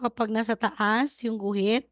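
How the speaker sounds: usual speaking voice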